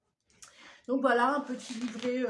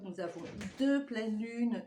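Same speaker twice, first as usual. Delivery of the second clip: ordinary talk, nothing marked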